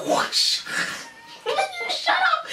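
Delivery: high, loud, slow protest through laughter; mock outburst, cracking up; laughing disbelief. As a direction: laugh out the telling-off. Her reaction to hyper woman, laughing.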